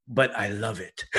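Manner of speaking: put-on a guilty French-accented admission breaking into a laugh; imitating a French accent, laughing. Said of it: "in English with a French accent".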